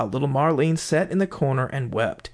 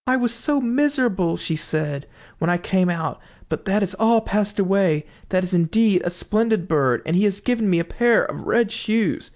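put-on happy little girl; 6 semitones higher than usual. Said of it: "quite happy and light-hearted".

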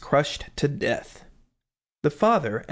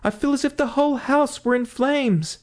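high, frightened cry; 12 semitones higher than usual. Acted. Wife begging husband not to go outside, frightened cry. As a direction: cry out to stop him, much higher. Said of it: "cried the wife".

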